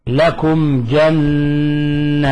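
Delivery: very slow gentle recitation, meem said clearly; easy flowing recitation. Shown as a demonstration. Teacher shows the correct easy reading, slow chant. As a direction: let لكم flow into جنات without pressing the meem. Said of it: "نقرأها بسهولة ويسر".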